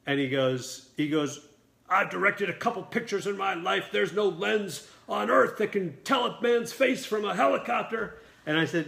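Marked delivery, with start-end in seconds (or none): 1.9-8.2 raised-pitch indignant rant; Burt Reynolds chewing out. Retold or imitated. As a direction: lecture the director in mock outrage. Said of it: "he starts chewing me out".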